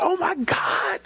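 high whispered joyful cry; overjoyed cry. Retold or imitated. Husband reacting to pregnancy news, high whispered joy. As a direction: gasp it out overwhelmed. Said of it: "I said".

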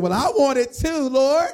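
high, loud whiny plea to the Lord; pleading friend. Retold or imitated. Friend begging God for the same blessing, high whine. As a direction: beg God like a jealous kid. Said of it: "He said".

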